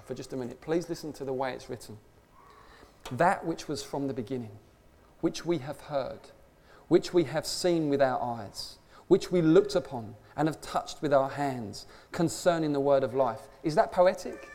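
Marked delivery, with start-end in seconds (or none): 3.1-13.5 slow scripture reading. Acted. Reads scripture aloud slowly.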